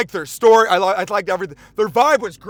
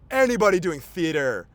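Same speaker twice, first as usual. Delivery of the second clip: normal talking voice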